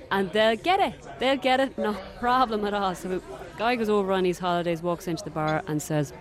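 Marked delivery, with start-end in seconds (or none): 0.1-2.9 put-on higher, cheerful Dublin accent. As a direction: promise it'll be grand in a Dublin accent. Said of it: "so I'm gonna use a Dublin accent".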